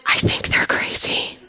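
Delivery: unvoiced, secret whisper of a private thought; whispered aside. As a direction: breathe it like a thought she'd never say aloud.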